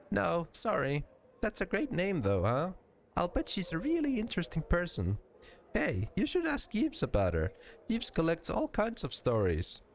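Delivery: put-on high-pitched girlish character; sweet innocent offering a tip; high voice, chatty and eager; friendly, helpful Nenny.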